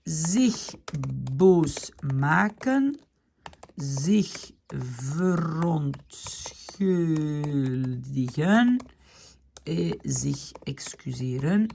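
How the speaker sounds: each Dutch word drawn out very slowly; slow, spelled-out reading of sich verontschuldigen; slow dictation. Shown as a demonstration. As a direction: stretch every syllable as if dictating. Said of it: "teacher reads the verbs aloud".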